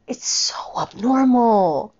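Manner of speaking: slow breathy whisper of disgust; whispered, appalled. Real, not acted. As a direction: breathe it out in revulsion. Disgusted at mother behaviour.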